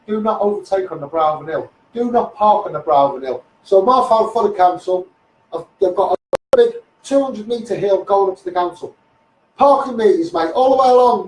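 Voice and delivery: ordinary talk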